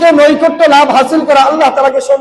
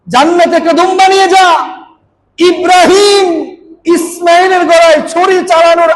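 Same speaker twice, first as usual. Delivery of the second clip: high, drawn-out preacher's order; urgent command; 5 semitones higher than usual. Acted. The Lord sending Jibril with the ram, urgent command.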